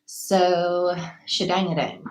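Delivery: usual speaking voice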